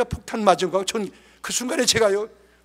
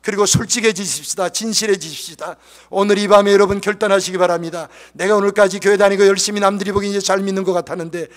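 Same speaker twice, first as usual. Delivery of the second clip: loud, driving altar call; forceful revival preacher's exhortation; full-force preaching; 9 dB louder than usual. Real, not acted. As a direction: press the congregation to decide, loudly.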